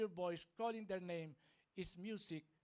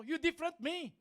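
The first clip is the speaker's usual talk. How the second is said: high, loud mock challenge; mock indignation; 10 semitones higher, 9 dB louder than usual. Preacher mimicking broken English for the crowd, mock indignation. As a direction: belt it out high, for laughs.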